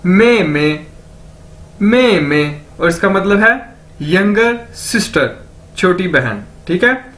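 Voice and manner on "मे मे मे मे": "मे मे" as two joined syllables; careful language-drill "मे मे"; demonstrated pronunciation. Shown as a demonstration. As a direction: model "मे मे" for learners, pausing between takes. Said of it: "दो बार बोला जाए तो".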